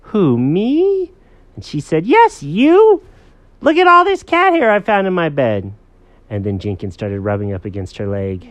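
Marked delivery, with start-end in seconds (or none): 0.0-1.1 high, loud mock-innocent question; high-pitched who-could-it-be cat voice. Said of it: "what he was saying was". 2.1-5.7 high, loud accusing voice; hammed-up high-pitched scolding; scolding girl.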